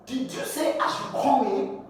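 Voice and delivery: high-pitched mock question. Acted question from other person.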